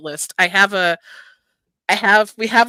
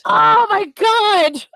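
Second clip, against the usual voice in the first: excited; 13 semitones higher, 7 dB louder, much slower than usual. Real, not acted. Excited about a favorite author.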